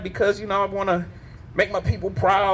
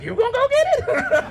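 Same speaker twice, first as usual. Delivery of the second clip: much higher, laughing dog voice; high, giggly voice of the dog; dog's voice. Pretend. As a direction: echo it back as the lazy dog, cracking up. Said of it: "The dog is like".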